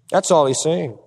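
usual speaking voice

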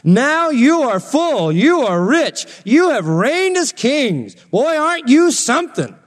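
high, slow mock-awed swoops; sarcastic. Paul mocking the puffed-up Corinthians, sarcastic. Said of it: "He is sarcastic".

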